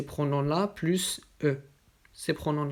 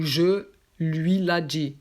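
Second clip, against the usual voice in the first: "je lui la dis" slower, louder; wrong form stressed; 4 dB louder, slower than usual. Shown as a demonstration. Teacher flagging a mistake, slow and emphatic. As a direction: flag "lui la" as the error.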